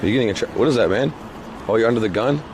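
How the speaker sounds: ordinary talk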